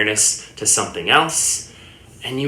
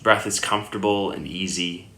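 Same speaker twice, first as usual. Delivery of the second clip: unhurried meditation guidance with long pauses; guided meditation; 2 semitones lower, 4 dB quieter than usual. Acted. Yoga teacher guiding listeners lying down into Yoga Nidra, calm meditation voice. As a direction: settle the listener gently, leaving long silences between phrases.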